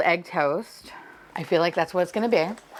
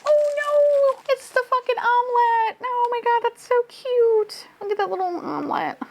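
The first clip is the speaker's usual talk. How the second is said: excited squeal. Real, not acted. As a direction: shriek in surprise. Surprise reveal.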